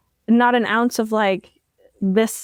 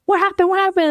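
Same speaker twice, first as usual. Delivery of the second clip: much higher and louder, urgent; startled mom; 10 semitones higher, 4 dB louder, faster than usual. Retold or imitated. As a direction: rush the question out like you've run from the shower. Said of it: "she was like".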